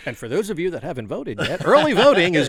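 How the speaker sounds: promo announcer voice. Acted. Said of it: "We need him to record our promos".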